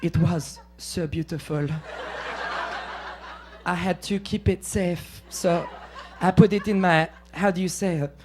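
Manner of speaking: a softly mocking French film accent; French film woman. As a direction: murmur it like a French art-film heroine. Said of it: "it's like a French film".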